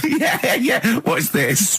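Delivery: high-pitched, slow words through laughter; cracking up while playing along; laughing. Real, not acted. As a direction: squeeze the words out through giggles. Cracking up at mock exam scene.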